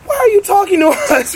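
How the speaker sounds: high, loud and laughing; giddy disbelief. Retold or imitated. Starstruck fans asking the singer why he talks to them, high and laughing. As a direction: squeak it out, starstruck. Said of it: "We're like".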